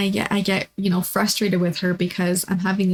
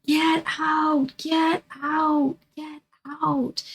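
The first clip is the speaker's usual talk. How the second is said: high, eerie retold shriek of get out; ghostly shriek; 8 semitones higher, much slower than usual. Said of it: "she would just shriek".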